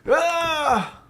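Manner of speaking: short, high startled yelp; startled scream. Real, not acted. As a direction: cry out high in fright. Startled while playing horror game.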